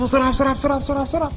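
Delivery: frantic high urging to run from police; urgent hurry. Real, not acted. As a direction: rush him to flee. Police arrive, urging escape.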